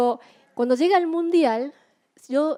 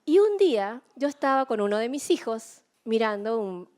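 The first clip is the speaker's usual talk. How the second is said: usual speaking voice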